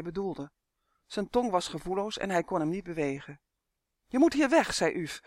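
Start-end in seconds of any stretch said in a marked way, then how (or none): 4.1-4.7 higher, louder elf voice; urgent, raised-voice warning. Acted. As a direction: press him to leave, pitched up. Elf Uf in dialogue.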